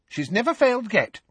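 firm, reassuring schoolgirl. A narrator's reading. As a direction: insist with confidence. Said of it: "said Alicia Spinnet firmly".

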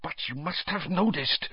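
put-on high, agitated, accented plea; Karkaroff, agitated. A narrator's reading. Karkaroff pressing Snape, agitated. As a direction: plead anxiously, pitched high. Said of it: "Karkaroff began in an agitated voice".

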